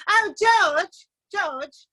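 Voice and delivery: high, gushing mock Sherman voice; airy, self-important imitation of a classmate. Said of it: "She says".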